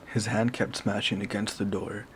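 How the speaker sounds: ordinary delivery, nothing marked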